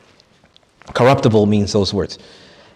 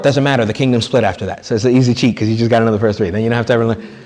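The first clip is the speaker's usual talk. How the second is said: normal talking voice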